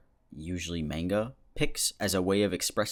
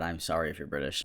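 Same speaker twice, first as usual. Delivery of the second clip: ordinary talk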